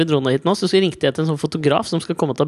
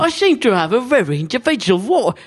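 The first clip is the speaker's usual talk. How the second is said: lightly voiced Michael Caine line; Michael Caine impression; 3 semitones higher than usual. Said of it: "det var det din, Michael Caine".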